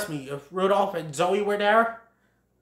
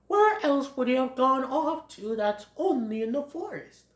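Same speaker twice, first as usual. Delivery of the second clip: lightly pitched-up character voice; pondering Thomas; 7 semitones higher than usual. Acted. Thomas wonders where Bambi went, pondering voice. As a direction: think aloud, a bit higher.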